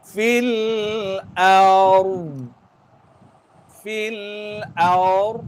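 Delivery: ard recited with tongue pressed up for dhad; teacher models fil ard; recitation demo. Shown as a demonstration. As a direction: stress the dhad in ard, tongue stuck to the palate. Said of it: "R tu bergegar, dha tu letak lidah".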